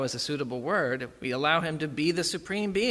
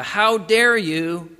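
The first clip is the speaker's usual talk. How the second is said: slow, loud, higher how dare you; loud mock outrage; 5 semitones higher, 8 dB louder, much slower than usual. Pastor voicing the self's proud anger, loud and slow. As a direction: hammer out each word in outrage.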